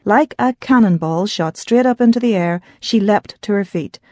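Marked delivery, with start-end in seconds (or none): none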